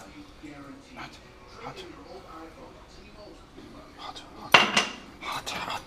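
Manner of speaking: whispered pained hot hot; hissing at scorching hot food; burning fingers. Real, not acted. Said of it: "finger burning hot".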